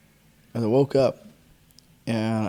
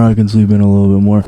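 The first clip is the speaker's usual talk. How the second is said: loud, fast, whining; hammed-up sleepy bargaining; loud whiny plea; 2 semitones lower, 13 dB louder, faster than usual. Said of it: "I completely was like".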